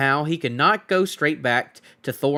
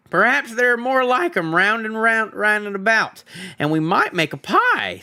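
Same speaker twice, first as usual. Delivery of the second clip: higher, louder troll voice; scheming troll Bert; 9 semitones higher, 3 dB louder than usual. Acted. Troll Bert plans to catch more hobbits, greedy. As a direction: plot a pie like a greedy troll.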